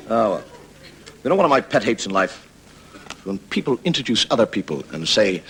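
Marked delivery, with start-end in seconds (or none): none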